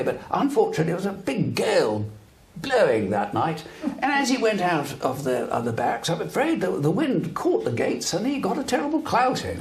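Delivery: high, plummy posh English officer voice; an army spokesman smoothing it over in posh English; posh English accent. Retold or imitated. Army spokesman explaining the beating to the BBC, posh English accent. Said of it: "in a very, very posh English accent".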